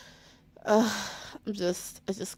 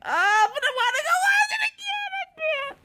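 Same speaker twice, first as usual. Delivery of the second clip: wailing sob; 3 dB louder than usual. Real, not acted. Wants to rewatch, wailing.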